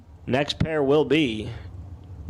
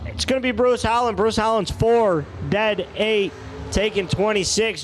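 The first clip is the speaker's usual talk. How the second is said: excited race call; 6 semitones higher than usual. Real, not acted. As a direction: call the launch with a jolt of real excitement. Race launches, announcer calls it.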